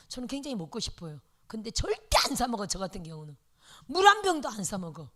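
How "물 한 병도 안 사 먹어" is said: emphatic. Shown as a demonstration. Pastor's wife insisting she never buys water, emphatic. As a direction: stress on 한, said louder. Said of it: "repeated louder then whispered".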